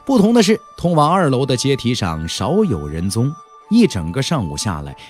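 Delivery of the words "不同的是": usual speaking voice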